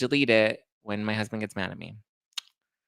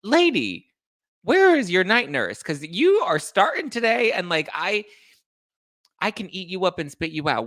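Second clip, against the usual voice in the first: high, loud retold put-down; mock-snap at a heckler; retold telling-off; 8 semitones higher, 5 dB louder than usual. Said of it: "and I was like".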